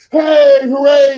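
high, slow drawn-out cheer; hammed-up hooray; mock cheer. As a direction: cheer it out big like a hype man. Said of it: "But he's saying".

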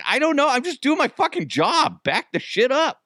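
high-pitched, exasperated self-defense; flustered retold outburst; mock defensive. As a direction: protest under attack in a hammed-up high voice. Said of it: "she's attacking me".

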